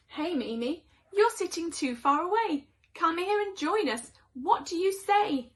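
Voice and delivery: beckoning call to a distant cat. Acted. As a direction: call a friend over from afar. Cat calls Mimi to join.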